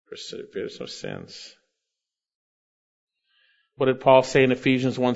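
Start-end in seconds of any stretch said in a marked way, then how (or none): 0.1-1.6 hushed echo. Real, not acted. Pastor echoes verse softly.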